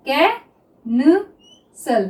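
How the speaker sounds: Officer drawn out very slowly; teacher dictating officer to students; slow dictation. Shown as a demonstration. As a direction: stretch officer as if writing it down. Dictating while writing shorthand.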